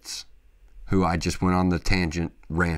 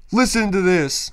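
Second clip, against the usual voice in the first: 1 hyped; 10 semitones higher, 6 dB louder than usual. Real, not acted. Hyped before the big quote.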